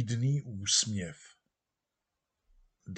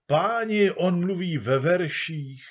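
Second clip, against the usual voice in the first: amazed crowd; 7 semitones higher, 4 dB louder than usual. Acted.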